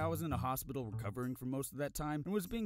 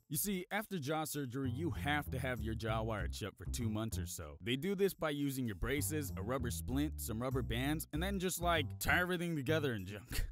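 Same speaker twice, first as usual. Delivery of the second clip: usual speaking voice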